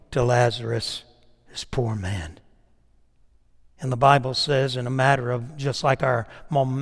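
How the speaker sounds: usual speaking voice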